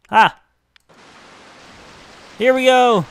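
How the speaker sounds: bright, eager announcement; loud and upbeat; excited. Real, not acted. Ride starts on tube slide.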